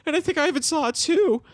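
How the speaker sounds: a hint of a panicked Bender. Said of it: "He's like".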